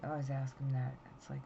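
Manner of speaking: ordinary delivery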